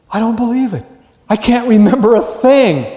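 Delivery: high, loud stunned student with a laugh; mock dismay. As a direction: play the shocked student who blanked. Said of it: "ready for the quiz".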